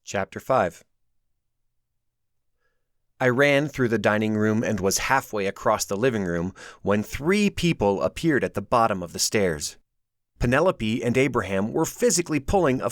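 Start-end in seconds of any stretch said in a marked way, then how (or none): none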